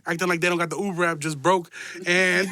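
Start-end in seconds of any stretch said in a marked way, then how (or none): none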